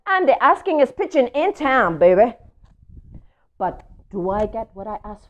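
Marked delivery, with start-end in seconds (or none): 0.1-2.4 brash pigeon voice, louder and higher; swaggering pigeon boast; cocky pigeon. Acted. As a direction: strut it like a show-off pigeon. Pigeon voice from book.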